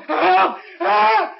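high, loud panicked calls for help; terrified cry for help. Acted. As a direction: yell for help as if your life depends on it. Man cries for help again.

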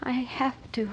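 breathy unvoiced whisper. [name] drifts off under hypnosis, whispering. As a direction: whisper it as she fades off.

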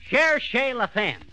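slow, savoured French phrase; smug French aside. Acted. Old marshal lecturing the sheriff, slow and smug. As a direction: drawl it out like a know-it-all.

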